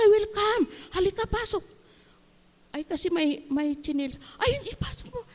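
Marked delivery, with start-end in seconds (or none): 0.0-1.6 very high, bright-eyed call to come in; eager welcome. Retold or imitated. Retold warm welcome at door. 4.4-5.2 eager urging. Retold or imitated. Retold urging to bring it in.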